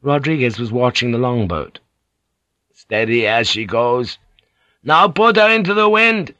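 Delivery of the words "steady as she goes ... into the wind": steady, then raised high command; helm orders. A narrator's reading. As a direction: call orders to the helmsman. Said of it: "he ordered the helmsman".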